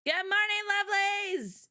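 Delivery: playful high lilt; sing-song greeting. Pretend. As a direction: sing out the greeting to your audience, pitched well up. Sing-song greeting opening the show.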